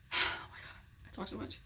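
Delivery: breathy whisper to yourself; whispered aside. Real, not acted. Vlogger catching herself rambling, whispering. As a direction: mutter it under your breath, self-conscious.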